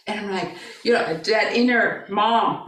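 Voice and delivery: ordinary talk, nothing marked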